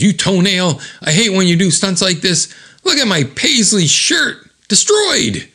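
high, indignant rant; mock angry. Kevin scolds over his ruined shirt, mock-angry high voice. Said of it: "He's mad".

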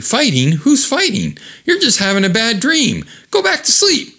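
very high, innocent denial; exaggerated high-pitched coaxing act; feigned innocence. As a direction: pipe up high, denying everything. Hero feigns innocence to Diane.

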